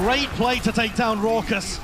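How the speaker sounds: loud, high, drawn-out praise; excited shout. Real, not acted. Caster hails a takedown for viewers, shouting excitedly. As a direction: hail the big play, loud and slow. Praising a big play live.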